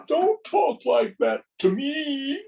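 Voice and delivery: high, loud offended protest; play-acted outraged retort; mock indignation.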